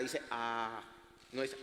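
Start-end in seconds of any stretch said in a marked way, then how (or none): 0.3-0.8 "A" held long. Shown as a demonstration. Preacher teaching Hebrew vowels to his congregation, long drawn-out A. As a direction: draw out the A as a long, open vowel. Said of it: "suena como una A larga".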